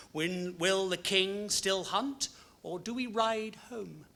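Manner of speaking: measured, expressive poem recital; poetry reading. Acted. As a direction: read each verse like a storyteller at dusk. Reads poems aloud.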